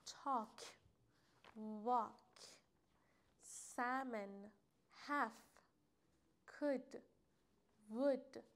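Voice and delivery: تاک، واک، سمن، هف، کد، وود each alone with no "l"; slow, separated model words, dropping the L in تاک and واک; silent L. Shown as a demonstration. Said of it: "اینا هیچ کدوم الشون تلفظ نمیشه".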